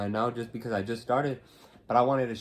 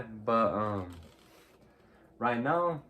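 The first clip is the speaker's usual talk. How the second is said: normal talking voice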